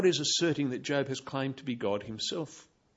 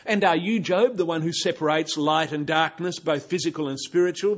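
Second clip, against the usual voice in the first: sarcastic rebuke; 3 semitones higher, 7 dB louder than usual. Pretend. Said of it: "full of sarcasm and rebuke".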